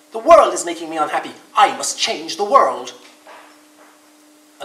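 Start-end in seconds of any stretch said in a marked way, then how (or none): 0.1-2.9 stubborn Rabbit. Acted. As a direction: declare it loudly and deliberately. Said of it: "said Rabbit".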